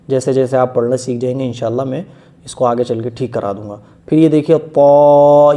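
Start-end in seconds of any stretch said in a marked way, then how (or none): 4.6-5.5 پا said thick and louder; heavy طا. Shown as a demonstration. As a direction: make پا fat and heavy. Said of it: "یہ موٹا کر کے پڑھا جائے گا".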